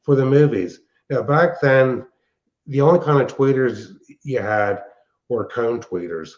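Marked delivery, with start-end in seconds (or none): none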